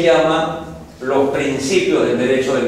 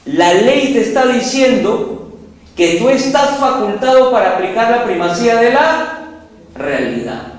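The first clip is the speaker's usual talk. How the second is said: voicing the law; 7 semitones higher than usual. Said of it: "lo que ha hecho la ley es decirle".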